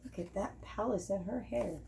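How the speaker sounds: quiet amazed aside; hushed marvel. A narrator's reading. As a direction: murmur it in wonder to the listeners.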